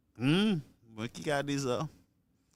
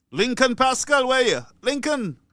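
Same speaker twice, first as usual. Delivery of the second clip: loud, high-pitched summons; calling out; 8 semitones higher, 7 dB louder than usual. Real, not acted. Host hollering for a missing regular caller, calling out. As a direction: holler for someone who is missing.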